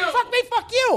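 very high, heated comeback; outraged retort. Acted. Bandleader trading curses with bandmate, high outraged yell. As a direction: throw the curse right back. Acted quarrel with band.

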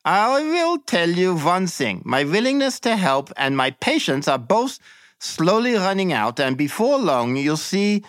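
silly chatty interrogator. Acted. Examining officer coaxing the prisoner to confess, high chatty voice. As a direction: coax him like a falsely friendly examiner.

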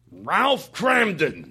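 Ralph Cramden impression. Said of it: "Do you do anybody".

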